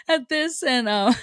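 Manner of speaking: giggling at her own restarts, pitch jumping up; breaking into real laughter mid-sentence; laughing. Real, not acted. Host laughs to listeners about her eighth take.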